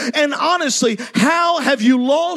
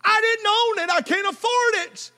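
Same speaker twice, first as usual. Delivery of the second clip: anguished cry; 6 semitones higher than usual. Acted. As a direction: cry it out in distress. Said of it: "he cried out".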